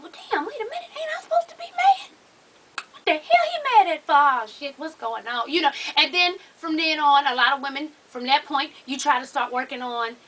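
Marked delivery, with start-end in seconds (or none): 0.0-5.5 put-on high, incredulous girlfriend voice; mock bewildered woman, pitch up; confused girlfriend. Confused woman realizing she's played.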